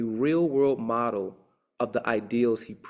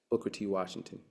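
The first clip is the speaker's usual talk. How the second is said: quiet, low, dropped name; soft attribution; 3 semitones lower, 7 dB quieter than usual. Shown as a demonstration. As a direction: let the name fall away softly.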